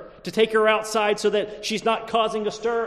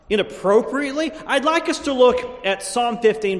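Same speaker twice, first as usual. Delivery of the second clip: louder, higher preacher build to the scripture; preaching; 4 semitones higher, 5 dB louder than usual. Acted. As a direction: lift the congregation toward the text.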